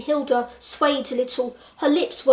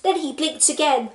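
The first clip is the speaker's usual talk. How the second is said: ordinary talk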